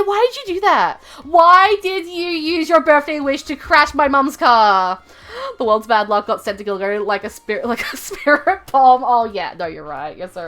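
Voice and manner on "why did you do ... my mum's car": mock accusation. Mock accusing chat friend.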